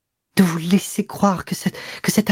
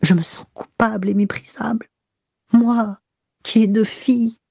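higher, breathless, near tears; panting anguish; 8 semitones higher than usual. A narrator's reading. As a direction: confess it gasping and breaking. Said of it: "d'une voix haletante".

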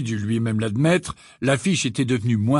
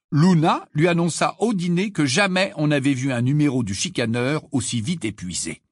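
ordinary delivery

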